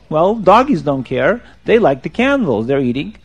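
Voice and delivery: ordinary delivery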